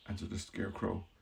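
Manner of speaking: ordinary delivery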